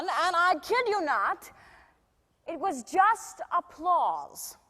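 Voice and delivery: theatrical star telling an anecdote; milk the story for the punchline; showy, amused raconteur. Acted. [name] tells the anecdote.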